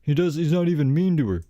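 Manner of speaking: a mocking know-it-all commenter; mocking the haters. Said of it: "So people are like".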